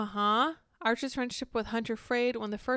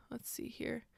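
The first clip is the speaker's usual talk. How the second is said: whisper; 12 dB quieter, slower than usual. Real, not acted. Muttering to herself reading.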